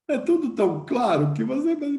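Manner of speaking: ordinary talk, nothing marked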